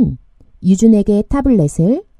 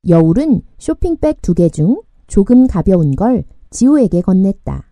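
usual speaking voice

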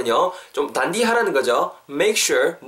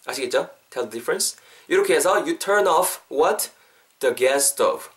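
ordinary talk, nothing marked

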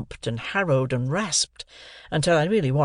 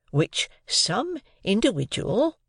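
slow, higher "some individual"; hint it gently once more; polite hint again; 8 semitones higher, slower than usual. A narrator's reading. Joe politely hinting again to his wife. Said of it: "Joe again politely hinted".

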